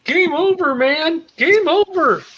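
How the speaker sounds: high, loud panicked cry of doom; panicked high wail. Acted. Player despairs that all is lost, high panicked wail. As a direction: wail that it's all over.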